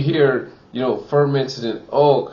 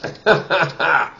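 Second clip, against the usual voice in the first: amused chuckle; 4 semitones higher than usual. Real, not acted. Amused at own joke.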